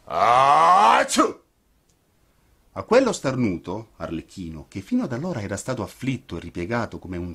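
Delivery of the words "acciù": huge theatrical sneeze rising high; hammed-up sneeze; loud sneeze. A narrator's reading. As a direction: explode into a booming sneeze. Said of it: "un sonorissimo starnuto".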